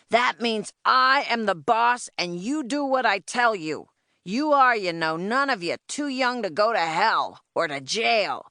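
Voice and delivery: domineering announcement to rowdy orphans; roaring, cursing boss. A narrator's reading. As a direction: lay down the law to a crowd, pitched high. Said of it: "Roaring and cursing".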